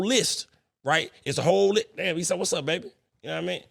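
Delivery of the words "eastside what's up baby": ordinary talk, nothing marked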